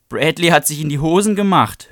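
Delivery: high, loud sing-song taunt; mocking jeer. Lori mocking the fallen Bradley, high loud jeer. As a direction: jeer at him like a schoolyard bully. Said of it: "höhnte Lori".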